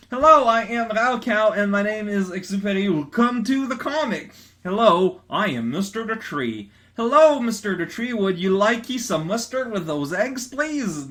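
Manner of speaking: high, loud goofy character reading; hammed-up comic voices. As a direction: ham up the silly dialogue.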